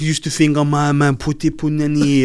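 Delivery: broad mock French accent; French Canadian accent. Podcaster joining in on wrestler impression, comic French accent. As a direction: ham up a Quebecois English accent. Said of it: "speaks with a French accent".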